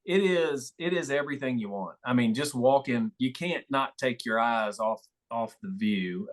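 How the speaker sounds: ordinary talk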